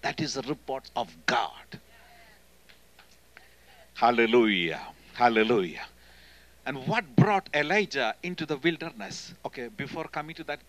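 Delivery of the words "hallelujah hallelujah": louder praise call. Real, not acted. Preacher calling out praise.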